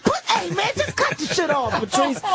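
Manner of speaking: high, urgent mock shout; retold yell. Catching a friend in the act, high urgent shout. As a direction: yell it at him, pitch way up. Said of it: "So I'm like".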